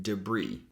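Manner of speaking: "debris" as duh-BREE, second syllable stressed; silent S. Shown as a demonstration. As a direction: say "debris" low, ending in long ee. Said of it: "that's how we say it".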